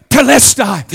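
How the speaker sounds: telestai as teh-LES-tai; Greek word. Shown as a demonstration. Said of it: "The Greek is literally".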